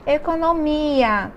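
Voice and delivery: Economia drawn out slowly, falling; slow model word. Shown as a demonstration. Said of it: "Olha aqui o ia". (Economia dita bem devagar)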